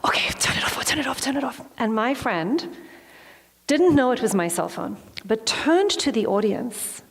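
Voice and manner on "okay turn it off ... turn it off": frantic whisper. Retold or imitated. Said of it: "and I'm like".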